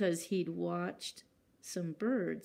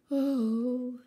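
sighing; 4 semitones higher, 6 dB louder than usual. Acted. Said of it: "sighed Mole".

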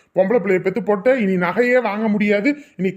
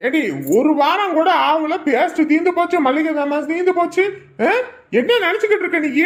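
scolding husband, pitched high; nagging his wife, voice climbing; angry scolding; 6 semitones higher than usual. Acted. Husband scolding wife over paste.

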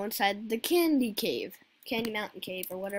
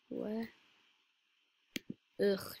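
ordinary delivery

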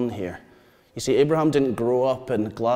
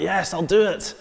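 higher-pitched eager yes; eager agreement; 6 semitones higher than usual. Acted. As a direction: jump in with willing enthusiasm. Acting an eager reply to God.

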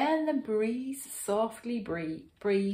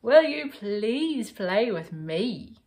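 loud pleading request; raised voice, asking to join in; calling out; 3 semitones higher, 8 dB louder than usual. A narrator's reading. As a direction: call out an eager invitation. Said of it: "called the bear".

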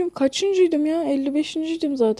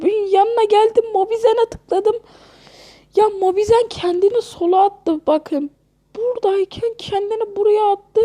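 outraged whining; 9 semitones higher, 3 dB louder than usual. Real, not acted. Angry rant about game glitch.

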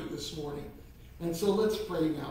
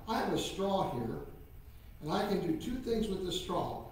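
usual speaking voice

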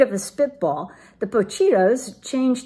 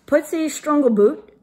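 louder ringmaster-style name call; booming introduction of the strong dog; grand announcement; 5 semitones higher, 6 dB louder than usual. A narrator's reading.

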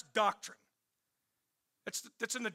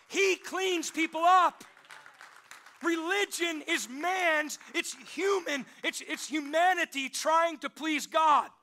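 loud, higher, slow preaching build; preaching at full force; 4 semitones higher, 3 dB louder than usual. Acted. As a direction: drive it home from the pulpit.

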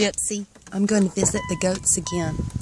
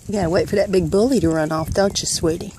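ordinary delivery

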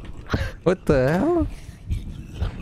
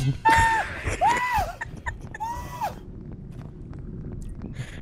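shrieking high laugh; laugh-scream in panic; panicked laugh-scream; 6 dB quieter than usual. Real, not acted. Caught by the killer.

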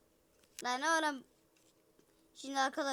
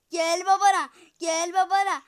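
loud taunt; 3 semitones higher, 12 dB louder than usual. Pretend. Loud taunt come to daddy.

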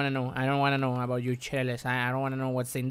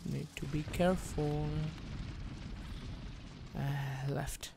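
very soft, hushed murmur; cautious self-talk; 2 semitones lower, 13 dB quieter than usual. Real, not acted. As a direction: talk to yourself barely aloud while creeping. Creeping carefully in game.

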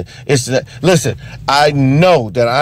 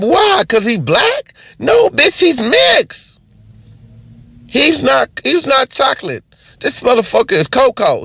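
exasperated rant; 11 semitones higher than usual. Real, not acted. To the audience about Obama being mixed, high exasperated yell. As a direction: hammer it home, voice way up.

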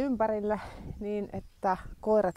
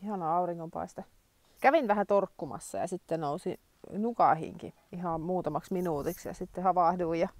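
ordinary delivery

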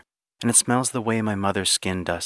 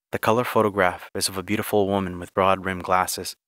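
ordinary delivery, nothing marked

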